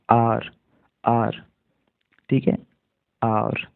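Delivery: hour said "आर", the h silent, like "our"; hour said as aar. Shown as a demonstration. Teacher shows students how "आर" sounds: silent h. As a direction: drop the h and say "आर". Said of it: "हावर नहीं पढ़ते इसको".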